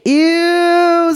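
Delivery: high squeaky "Ew"; disgusted little girl. Acted. Little girl grossed out by a rubber snake, high pitched. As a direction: recoil like a small girl. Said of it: "said the little girl".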